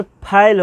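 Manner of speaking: फेल said with a gliding "ai", like "pha-il"; फेल with an open, gliding vowel; common mispronunciation. Shown as a demonstration. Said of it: "अक्सर हम लोग कहते क्या?".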